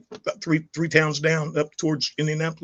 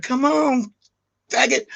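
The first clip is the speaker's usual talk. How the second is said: pitched-up taunt daring him to come; taunting challenge; 8 semitones higher, slower than usual. Retold or imitated. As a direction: goad the guy charging at you. Son's friend running at him.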